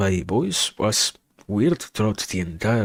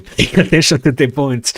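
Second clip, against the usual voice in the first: loud, higher words broken by laughter; laughing; 6 semitones higher, 9 dB louder than usual. Real, not acted. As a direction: laugh through the line. Laughing over surprise reward.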